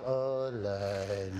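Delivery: low, drawn-out, weary sigh; weary groan. Pretend. Preacher mocking someone dragging through life, low weary. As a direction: drag the words like a tired soul.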